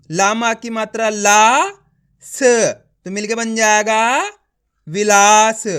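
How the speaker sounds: slow teacher's blending of "विलास", drawn-out long vowel; letter-by-letter sounding out. Shown as a demonstration. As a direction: stretch "ला" long, cut "स" off alone, then blend into a loud "विलास". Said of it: "एक एक अक्षर का उच्चारण करके".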